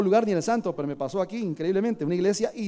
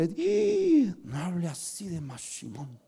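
mock scandalized devotee; slower than usual. Preacher voicing a believer scandalized by talk of Mashimón. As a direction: play the offended believer hushing him.

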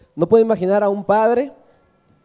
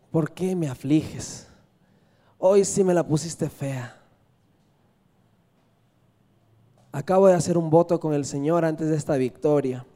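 grieving father; 4 semitones lower, 5 dB quieter than usual. Acted. Father laments to his daughter.

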